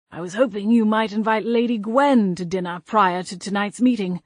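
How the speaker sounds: a hint of a timid English ghost, asking politely; lightly voiced old ghost gentleman. Hayward's voiced dialogue.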